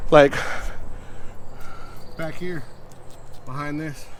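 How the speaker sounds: winded from the climb; out of breath. Real, not acted. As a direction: talk while catching your breath. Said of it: "let me catch my breath".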